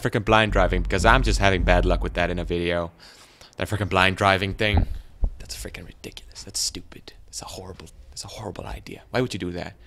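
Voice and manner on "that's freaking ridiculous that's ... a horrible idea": whisper. Real, not acted. Whispered complaint about blind driving.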